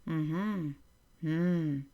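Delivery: relaxed hum on a steady comfortable note; neutral humming. Shown as a demonstration. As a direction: hum as if answering the phone. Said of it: "find your neutral note by humming".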